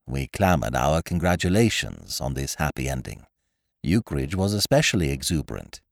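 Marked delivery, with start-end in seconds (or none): none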